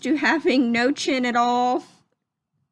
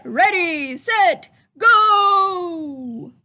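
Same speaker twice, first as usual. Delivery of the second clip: race starter call; 6 semitones higher, 10 dB louder, much slower than usual. Acted. Race start called out in story.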